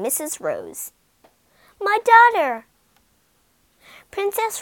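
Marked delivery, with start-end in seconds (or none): 1.8-2.7 loud, much higher joyful cry. Acted. Parent crying out on seeing their daughter again, loud. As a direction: cry out in reunion joy.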